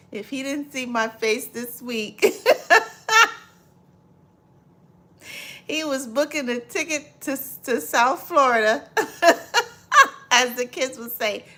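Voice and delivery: high, loud, reading through laughter; laughing while reading. Real, not acted. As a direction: read it out while cracking up. Rereading comment while laughing.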